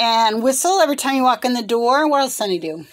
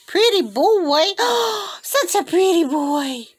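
high cooing with a gasp; gushing pet talk; 8 semitones higher, slower than usual. Real, not acted. Owner praising a pretty bird, high gushing coo.

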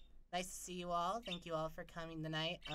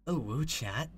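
twinkling voice attempt; 3 semitones lower, 6 dB louder, slower than usual. Shown as a demonstration. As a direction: lower take on a cutesy character voice. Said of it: "Is that?".

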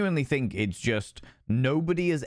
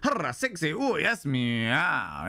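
preening self-admiration, pitched up; vain mirror talk; 5 semitones higher than usual. Retold or imitated. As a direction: purr at your own reflection, playfully. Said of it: "and go".

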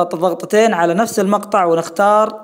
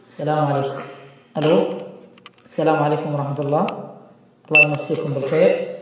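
hall reverb; 3 semitones lower, 4 dB quieter than usual. Shown as a demonstration. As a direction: voice in a big room. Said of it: "الصدى هذا مثل الغرفة بالضبط".

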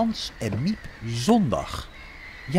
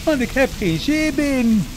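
Jojo's voice; 11 semitones higher, 5 dB louder than usual. A narrator's reading. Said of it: "dacht Jojo".

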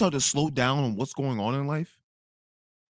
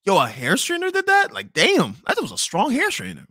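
stunned disbelief; 7 semitones higher than usual. Retold or imitated. Confused in the lobby, high incredulous disbelief. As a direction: pitch it up in total disbelief.

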